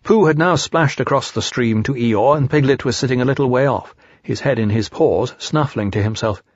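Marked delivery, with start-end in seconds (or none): none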